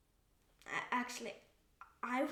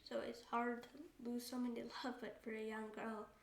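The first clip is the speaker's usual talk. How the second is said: subdued grief; 6 dB quieter than usual. Real, not acted. Reflecting on losing mother.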